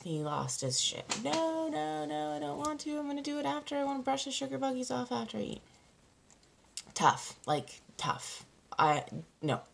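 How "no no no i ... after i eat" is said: put-on high, fast whining child protest; whiny kid voice. Mother imitating her son's refusal, high whiny child voice. Son refusing to brush teeth.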